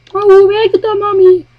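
put-on high innocent kid question; curious child. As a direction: ask like a puzzled small child. Child asking mother in skit.

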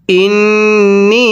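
slow, drawn-out melodic chant; Quran recitation. Shown as a demonstration. Teacher chanting the verse for students, slow melodic recitation. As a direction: stretch each vowel in a slow recitation tune.

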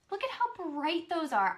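normal talking voice

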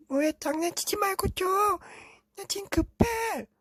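put-on very high kid's voice; little brother begging his brother for tissue; little brother calling. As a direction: call out like a small child stuck on the toilet. Younger brother urgent on toilet.

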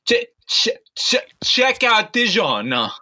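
hammed-up Italian, stammering a little; mock Italian accent. Acting Italian pizza chef.

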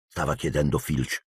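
plain reading voice

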